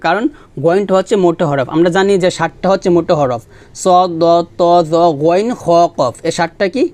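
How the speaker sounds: normal talking voice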